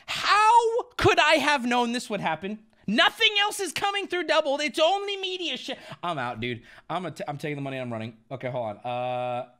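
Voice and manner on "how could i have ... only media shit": high, loud, frustrated protest; pleading your case, voice pitched up and loud; exasperated rant. Real, not acted. Exasperated streamer ranting at echo.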